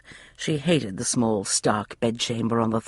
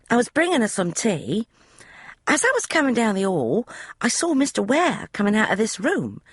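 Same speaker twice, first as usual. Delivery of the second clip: put-on high, eager young maid's voice; a chatty servant girl, pitched high; maid Polly; 10 semitones higher than usual. Maid Polly explaining the room to Emma, high eager voice.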